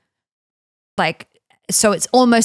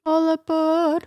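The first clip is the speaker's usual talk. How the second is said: mock conductor; 6 semitones higher, 4 dB quieter, slower than usual. Train conductor joke.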